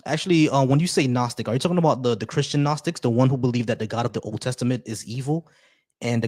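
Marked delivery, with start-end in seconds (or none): none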